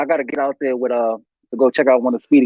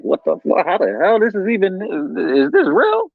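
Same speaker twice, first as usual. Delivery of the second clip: disbelief; 7 semitones higher than usual. Retold or imitated. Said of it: "I'm like".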